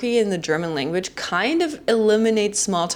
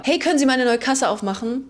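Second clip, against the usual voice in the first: mock-annoyed call from the back of the line, a bit higher; imitated German yell; 7 semitones higher than usual. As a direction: play a grumpy older German shouting at the checkout. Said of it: "is going to yell".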